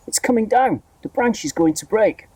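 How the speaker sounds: high alarmed cry; alarmed shout. A narrator's reading. Aunt fears the branch will break, alarmed. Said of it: "Aunt Sponge shouted".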